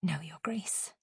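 very soft, cowed reply; subdued submission. A narrator's reading. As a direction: give in under your breath. Swallows anger, submits to superior.

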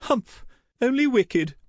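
very high, loud huff; indignant high-pitched mother; sniffing disdain. A narrator's reading. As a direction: huff it with wounded disapproval. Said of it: "Mirabell sniffed".

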